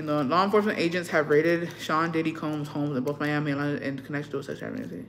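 ordinary delivery

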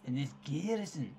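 Scottish lilt, hammed up; put-on Scottish accent. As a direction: read it in a bad Scottish accent. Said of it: "Why can't he be Irish?".

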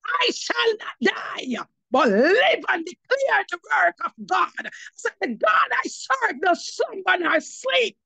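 high, slow, fervent scripture proclaiming; proclaiming the psalm with full conviction; preaching declaration. Retold or imitated. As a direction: cry the verse out like a battle shout. Declaring scripture against death.

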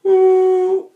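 high leaking falsetto sob; sobbing falsetto cry; sob demo. Shown as a demonstration. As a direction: let a high sob leak out. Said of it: "And you go".

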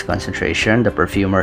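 usual speaking voice

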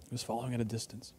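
very soft, low echo of the line; hushed repeat. Real, not acted. Same refrain repeated almost inaudibly.

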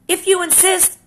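stag beetle accepts. Acted.

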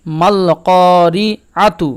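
"মাল করি আতু" very slow, full l and long vowel on "ক"; slow model recitation of "মাল করি আতু"; lam fully pronounced. Shown as a demonstration. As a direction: draw out "করি" after a clear "মাল". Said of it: "লামের উচ্চারণ পূর্ণভাবে হবে".

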